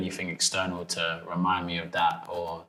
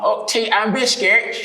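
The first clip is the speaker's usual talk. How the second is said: high, loud mock British accent; hammed-up fake British accent, drawn out slowly; Kevin Hart; 12 semitones higher, 5 dB louder, slower than usual. Mocking Americans' fake British accent to the interviewer, hammed-up impression. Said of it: "you guys sound like Kevin Hart".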